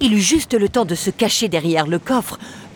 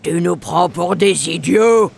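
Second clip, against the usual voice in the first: suspicious growl; 3 dB louder than usual. Acted. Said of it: "Les pirates grognèrent, méfiants".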